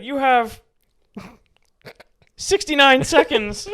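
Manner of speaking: very high, loud, slow; villain voice. Acted. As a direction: stretch it out like a hammy villain.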